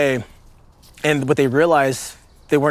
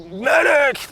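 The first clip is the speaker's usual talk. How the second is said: sharp, much higher shout of Medic; retold yell for a medic; 12 semitones higher than usual. Wounded men calling the Delta medic, a high mock yell. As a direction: cry out for the medic across the building. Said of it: "you're hearing the".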